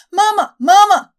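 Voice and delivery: high, loud wailing cry for mama; wailing child. Acted. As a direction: wail like a lost little boy. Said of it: "a boy of seven or eight wailed".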